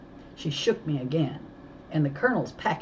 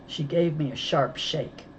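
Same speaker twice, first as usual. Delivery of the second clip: usual speaking voice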